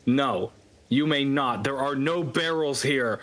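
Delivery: usual speaking voice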